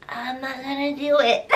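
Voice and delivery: lightly whined refusal; whiny voice. Pretend. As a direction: whine it back like a brat. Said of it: "whine my response back".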